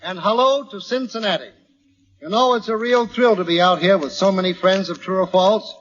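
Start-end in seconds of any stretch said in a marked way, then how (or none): none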